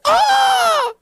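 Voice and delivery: sudden high yelp of fright; scream. Retold or imitated. Girl leaping out the window, high startled scream. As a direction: shriek like you just leapt.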